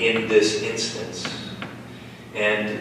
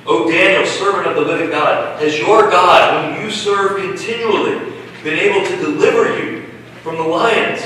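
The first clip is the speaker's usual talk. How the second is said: higher, louder cry of a worried king; the king pleads into the den, anguished and loud; 8 semitones higher, 4 dB louder than usual. A narrator's reading. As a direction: call out to Daniel in anguish. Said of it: "he cried out in a tone of anguish".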